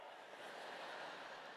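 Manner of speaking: breathy burst of laughter; real laugh. Real, not acted. Preacher laughing at his childhood fire story, real laugh. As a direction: laugh at the memory.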